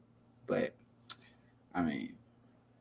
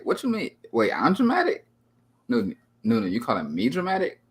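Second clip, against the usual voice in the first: higher, affronted mock outrage; mock indignation; 3 semitones higher, 3 dB louder than usual. Streamer answering chat calling him dramatic, mock-offended. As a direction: act scandalised at being called dramatic.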